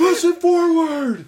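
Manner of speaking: high, loud, slow yell; mock exasperated fan. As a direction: holler it from the stands in parody. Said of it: "so he's like".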